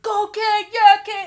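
high loud sideline cheer; cheering shout. Retold or imitated. Dad cheering his daughter at the game, loud shout. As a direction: yell it from the sideline.

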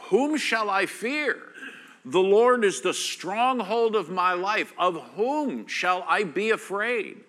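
loud, high, ringing proclamation; preaching at full force. Acted. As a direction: proclaim it over the congregation.

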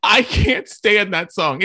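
loud, drawn-out exasperated verdict; emphatic disgust. Real, not acted. Co-host condemns the worst song of the year, emphatic disgust. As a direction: hammer it out slowly, voice rising. Ranting about a hated song.

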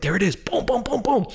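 high, excited burst, booming along. Retold or imitated. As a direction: call it out high as the cut lands. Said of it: "just get excited".

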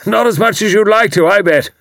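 loud, high hearty military man; retired commander teasing his neighbour, loud and hearty; bluff old commander. A narrator's reading. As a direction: boom it out like a blustering retired officer. Haydock voiced.

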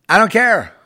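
loud, high defiant "I don't care"; defiant shout. Real, not acted. Repeated defiant line, shouted.

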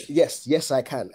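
ordinary talk

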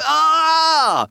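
long, high, loud "aah" of frustration; exasperated cry. Acted. Dad stumped by his daughter's poo questions, exasperated cry. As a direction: let out a drawn-out helpless wail.

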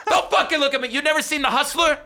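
ordinary delivery

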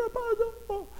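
put-on very high, thin child's call; little girl calling. Daughter imitated calling to Rapunzel, very high call. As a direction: call out like a little girl spotting her princess. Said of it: "and Kinsley's like".

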